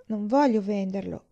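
usual speaking voice

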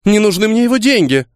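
upset outburst. Acted. Daughter rejecting the captain's inheritance, upset outburst.

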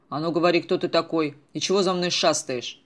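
loud, rough interrogation; a tough grabbing a kid and barking at him; menacing demand. Acted. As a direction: shove the question at him, loud. Killer grabs and interrogates boy.

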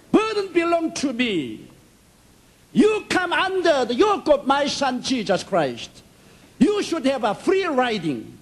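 high, solemn divine voice; lofty God speaking to his son. Retold or imitated. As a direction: proclaim as God from above. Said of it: "God came to me and revealed".

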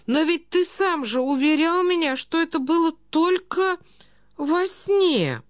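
put-on high, slow, doddering old prince; befuddled old prince. A narrator's reading. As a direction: plead feebly in a high-pitched old man's voice. (старый князь, высокий растерянный голос)

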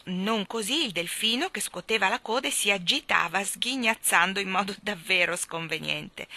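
plain reading voice

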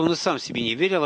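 plain reading voice

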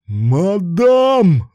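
very high, loud yell. A narrator's reading. Bound man yells out to his partner, yelling. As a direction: yell the word out at full force. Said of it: "заорал Руслан".

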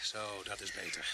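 soft, slow relief; relieved. Acted. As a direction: settle it down quietly. Knife taken, tension released.

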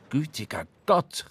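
startled exclamation; surprised. Acted. English captain startled by Ahab's outburst, surprised. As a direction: blurt it out, taken aback. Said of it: "rief überrascht der englische Kapitän".

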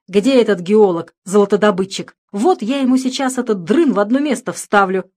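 громкий раздражённый окрик; рявкни, что разбудили зря; сердитый крик. Acted. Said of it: "недовольный, что потревожили, крикнул". (ворчливый крик Фёдора у ямы)

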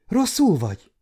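put-on a girl's startled, worried question; frightened. A narrator's reading. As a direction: ask in sudden fright for him. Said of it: "kérdezte ijedten Marcella".

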